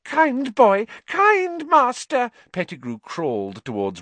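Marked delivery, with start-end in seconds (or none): 0.0-2.3 high, slow fawning squeak; fawn, drawn out and high; obsequious. Acted. Pettigrew fawns on Ron.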